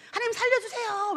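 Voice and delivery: high panicked plea; falling man's high desperate plea; crying for help. Retold or imitated. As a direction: beg God to save you while falling. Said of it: "외치고는".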